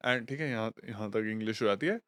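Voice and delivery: ordinary delivery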